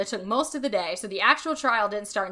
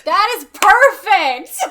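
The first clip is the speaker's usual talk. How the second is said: thrilled praise; 11 semitones higher, 15 dB louder, much slower than usual. Real, not acted. Delighted reaction to cohost's reading.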